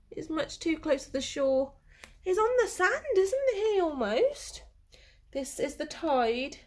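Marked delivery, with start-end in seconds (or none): 2.2-4.6 worried surprise. Acted. Worried question to children.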